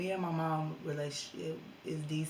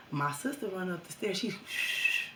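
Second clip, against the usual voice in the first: ordinary talk, nothing marked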